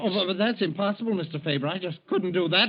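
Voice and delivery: flustered clerk begging his boss; panicked protest. Acted. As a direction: plead in alarm with the boss. Clerk panics at forced leave.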